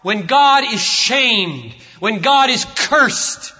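slow, loud, ringing preaching; thundering sermon climax; full-force preaching. Acted. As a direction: proclaim each line slowly, building to a shout. Preaching climax over music.

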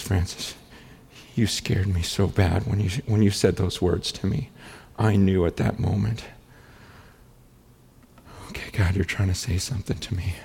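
low, subdued retelling of a confession; hushed confession. Retold or imitated. Staff member admitting to his pastor he was scared, hushed and grave.

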